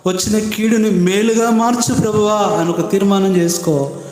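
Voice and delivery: rising, repeated pleas building in fervor; fervent prayer. Acted. Preacher leading the church's new-year vow, growing fervent.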